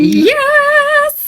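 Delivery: high squealed yes; excited. Real, not acted. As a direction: squeal with delight. Excited agreement.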